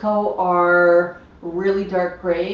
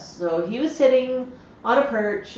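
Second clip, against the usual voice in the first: ordinary delivery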